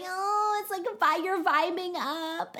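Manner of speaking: high, floaty teasing imitation of her own pep talk; mock hippie. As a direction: float it up high to needle him. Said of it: "but I was like".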